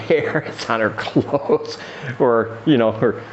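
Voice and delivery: ordinary talk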